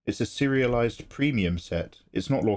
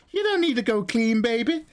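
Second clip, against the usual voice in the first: high-pitched coaxing voice; Nathan; 11 semitones higher than usual. Acted. Nathan talks Mikey out of going clean, high coaxing impression. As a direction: wheedle sweetly, pitched way up. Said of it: "that's what Nathan sounds like".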